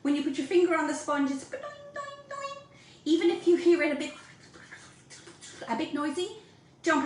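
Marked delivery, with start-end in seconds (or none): 1.5-2.6 high, soft boing-boing-boing; springy boing noise. Shown as a demonstration. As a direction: mimic a springy sponge bouncing back. Imitates springy sponge sound.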